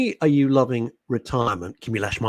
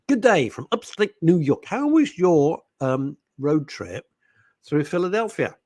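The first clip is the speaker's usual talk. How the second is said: hammed-up reading; 6 semitones higher than usual. Reading listener message dramatically.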